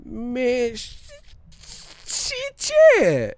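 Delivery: high, squealed stammer with a snapped finish; Harlem Nights stutterer. Retold or imitated. Reminding listeners of a movie stutterer, high blocked stammer. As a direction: strain on the first sound, then burst it out. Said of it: "Harlem Nights dude with the stutter".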